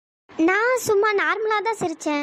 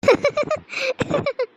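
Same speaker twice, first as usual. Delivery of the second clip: high staccato laugh; short high bursts of laughter; 7 semitones higher than usual. Acted. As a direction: laugh in high, quick pulses. Laughing aloud in an acted scene.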